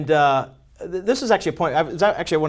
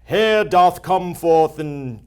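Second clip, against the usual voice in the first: put-on slow, grand old-English reading; mock archaic voice; 3 dB louder, slower than usual. Mocking how marketers read their own emails, slow archaic intoning. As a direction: intone it like a medieval decree. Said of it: "read it in this".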